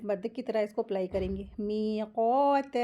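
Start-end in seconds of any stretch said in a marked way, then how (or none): 1.6-2.8 मीक़ात with both long vowels held slowly; drawn-out recitation. Shown as a demonstration. Teacher models Quran word, long vowels held in मीक़ात. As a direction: stretch मीक़ात out, unhurried. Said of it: "इस तरीके से".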